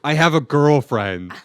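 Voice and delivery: hammed-up public declaration; mock announcement. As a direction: shout it to the whole room, dragging it out. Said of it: "run back on stage and be like".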